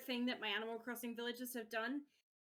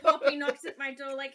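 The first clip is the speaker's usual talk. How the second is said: ordinary delivery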